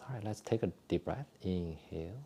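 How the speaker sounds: soft, calm breathing cues with whispered exhales; guided exercise instructions. Acted. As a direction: talk the class gently through each movement. Leads a qigong breathing routine.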